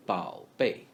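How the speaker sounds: bao bei in clear Mandarin tones; Mandarin pronunciation. Shown as a demonstration. Teacher repeating bao bei after explaining it. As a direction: model bao bei carefully. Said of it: "So bao bei means baby".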